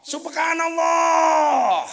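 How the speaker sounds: long, high, loud correction cry; drawn-out mock chorus shout; congregation calling out. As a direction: call it out like a whole congregation in unison. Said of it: "Makmum kompak".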